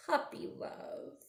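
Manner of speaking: tender whispered aside. Acted. Pointing out a puppy kiss, tender whisper. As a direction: whisper it like a secret.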